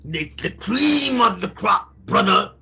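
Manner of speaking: slow, gruff wrestling promo growl; Hulk Hogan impression. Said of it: "Is Hulk Hogan".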